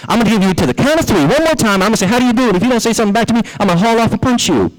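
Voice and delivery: high-pitched, rapid-fire ultimatum; furious rabbit. Acted. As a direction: act out a bossy rabbit losing his temper. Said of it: "He's getting furious and says".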